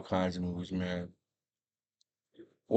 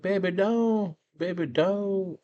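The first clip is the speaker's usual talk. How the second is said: pleading; 11 semitones higher, slower than usual. Retold or imitated. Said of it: "He's like".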